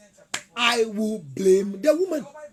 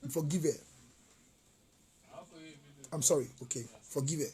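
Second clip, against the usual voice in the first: low, soft plea for forgiveness; hushed, low-pitched apologetic aside; subdued apology; 8 semitones lower, 11 dB quieter, faster than usual. Real, not acted. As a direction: drop right down and apologise quietly. Subdued apology on someone's behalf.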